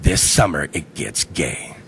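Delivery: deep, slow trailer announcer voice; growly movie narrator voice. Pretend. Fake movie trailer voice-over.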